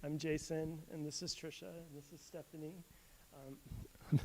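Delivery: nervous and quiet before a crowd; shaky soft start, nervous laugh; stage fright. Real, not acted. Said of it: "sorry, I'm a little nervous".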